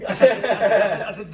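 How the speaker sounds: breaking into loud laughter mid-sentence; words pushed out through a real laugh. Real, not acted. Caught out by his friend, laughing loudly. Laughing while admitting what he said.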